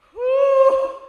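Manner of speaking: high loud exhale of relief; whew. A narrator's reading. The host on stage, relieved after the song, loud whew. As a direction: blow out a big high whew. Narrator voicing character on stage.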